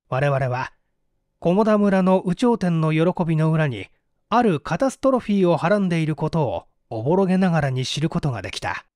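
ordinary delivery

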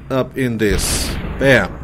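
ordinary delivery

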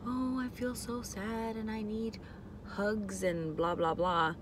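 soft, slow, whiny self-pity; mock self-pity. Imitating herself being needy with family, mock sad. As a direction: pout out a mock sob story. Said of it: "deep and like".

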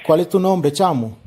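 ordinary talk, nothing marked

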